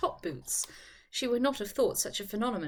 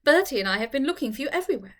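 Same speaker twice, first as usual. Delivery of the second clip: lively Charlotte; 4 dB louder than usual. Acted. Charlotte teasing her upset friend, laughing lightly. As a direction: toss it off as a joke.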